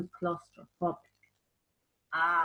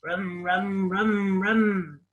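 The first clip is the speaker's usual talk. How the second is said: traffic noise; much slower than usual. Shown as a demonstration. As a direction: rumble like passing cars.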